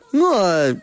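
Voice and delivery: usual speaking voice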